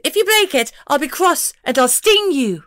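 high, loud pixie voice, threatening. A narrator's reading. Pixie to Scott, threatening in a high, loud voice. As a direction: warn him you'll sting.

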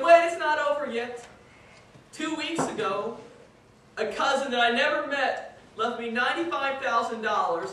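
high, loud, slowed wail of fake grief; mock wailing protest. The sobbing man wails about his inheritance, hammed-up. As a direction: wail it like the windfall is a tragedy.

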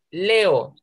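LEO with the E and O drawn apart; separated vowels. Shown as a demonstration. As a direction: stretch "Leo" into two beats, le-o. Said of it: "tenemos que separarlas".

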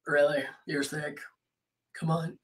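quiet, low mock disbelief; doubting. People doubting that you are sick, softly voiced disbelief. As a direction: imitate skeptical people under your breath. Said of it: "people are like".

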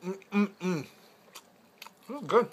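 words muffled by food; chewing while talking. Real, not acted. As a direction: mouth full of pizza. Seen 3: Bite at -2s; lower face moves with pizza held nearby.

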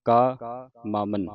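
long ਕਾ on its own, then ਮਾਮਨ; ਕਾ held, pause, ਮਾਮਨ as one word; separated reading. Shown as a demonstration. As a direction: slowly split ਕਾ away from ਮਾਮਨ. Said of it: "ਵੱਖਰਾ ਪੜ੍ਹਨਾ ਹੈ".

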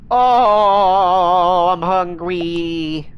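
long, slow, loud hungry moan; dragged-out whine of hunger; drawn-out whine. Real, not acted. Whining that he is hungry.

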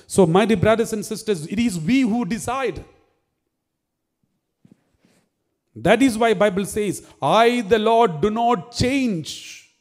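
fiery preacher pressing his point; full-force preaching. Acted. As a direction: preach it out to the congregation.

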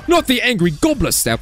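playful British exclamation; British accent. Pretend. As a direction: dread it in a put-on British accent. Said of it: "British accent this video when I say".

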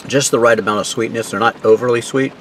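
words muffled by chewing; talking while chewing. Real, not acted. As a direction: mouth partly full of food. Seen 1: bites at -1.0, mouth working and pursed while speaking.

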